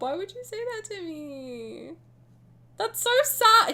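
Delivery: fake-cry the line to milk the sadness; mock crying. Streamer pretending to weep over Silas, mock sob.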